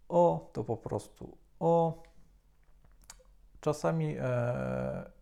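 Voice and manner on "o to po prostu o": usual speaking voice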